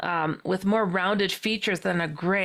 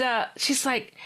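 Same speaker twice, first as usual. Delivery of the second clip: normal talking voice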